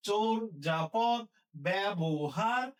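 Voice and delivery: drawn-out teaching pronunciation; slow syllable demo. Shown as a demonstration. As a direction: say চোর, যাপদ slowly and deliberately, part by part. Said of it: "এভাবে আওয়াজ করবা এবং লিখবা".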